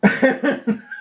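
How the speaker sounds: real high chuckle; amused laugh. Real, not acted. Remembering the officer who assaulted him, amused laughing. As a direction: laugh at the memory.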